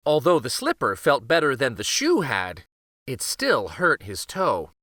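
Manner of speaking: ordinary delivery, nothing marked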